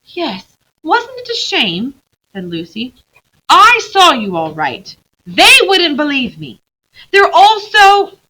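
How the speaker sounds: put-on a child protesting that nobody believed her; Lucy complaining to Aslan, high and loud; upset young girl.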